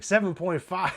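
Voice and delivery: acted-out scoffing question; incredulous echo. Acted disbelief at lower offer.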